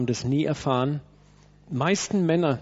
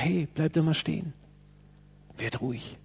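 calming; 5 dB quieter than usual. Pretend. God calming a fleeing person.

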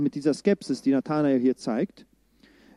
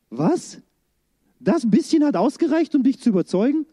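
mock astonishment, leaping high; Jesus; 7 semitones higher than usual. Jesus teasing easily convinced Nathanael, mock astonished. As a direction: play up amazed disbelief at how little it took.

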